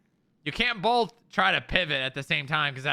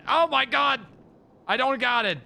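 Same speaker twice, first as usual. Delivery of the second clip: alarmed; 8 semitones higher, 4 dB louder than usual. Real, not acted. Couch slipping, alarm.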